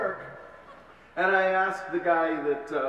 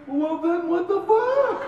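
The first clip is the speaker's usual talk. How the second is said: slow, much higher, laughing mock shout; exasperated mother shout; 13 semitones higher, 5 dB louder, slower than usual. Mother yelling at her son in laughing exasperation. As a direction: throw up your hands and yell.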